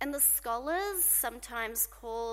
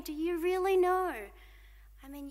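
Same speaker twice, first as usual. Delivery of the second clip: ordinary delivery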